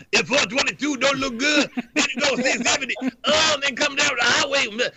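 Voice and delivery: high, laughing [name] style traffic report; [name] impression. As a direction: mumble the traffic like the Godfather of Soul. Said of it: "[name] would kick into [name] traffic reports".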